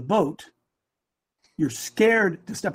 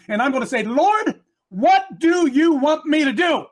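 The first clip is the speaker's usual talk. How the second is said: higher-pitched, emphatic sermon delivery; fervent preaching; 7 semitones higher, 3 dB louder than usual. Real, not acted. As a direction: preach it with rising urgency.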